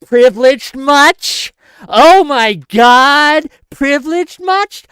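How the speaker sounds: loud, high, drawn-out mock scold; hammed-up outrage, imitating online scolds; mocking the critics. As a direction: exaggerate their scandalized scorn, slow and loud. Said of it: "And people are like".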